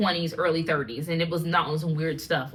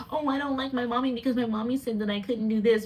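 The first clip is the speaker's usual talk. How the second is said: higher-pitched mock little-boy grievance; mock childish complaint; 4 semitones higher than usual. American man complaining about his mommy, mock childish complaint. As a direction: imitate a petty, sulking mama's boy.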